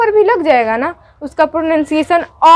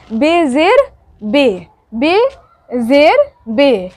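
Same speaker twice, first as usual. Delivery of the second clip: very slow, spelling out बेज़ेर बे; slow drill; much slower than usual. Shown as a demonstration. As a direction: drill it slowly for students. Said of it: "slow drilling syllables".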